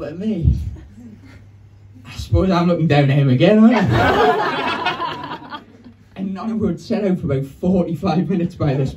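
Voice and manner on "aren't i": squeaky aside. Real, not acted. Self-aware aside.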